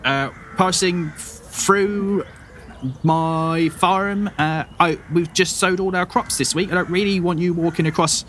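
high, put-out farm wife guarding her crops; annoyed farmer. Acted. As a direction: shoo strangers off her farm. GM voices farmer woman.

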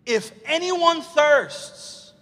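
high, drawn-out preacher's yell; loud, stretched-out proclamation. Retold or imitated. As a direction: cry it out slowly over a noisy crowd. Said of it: "what does Jesus yell out".

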